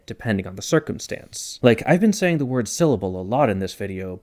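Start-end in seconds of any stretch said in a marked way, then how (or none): none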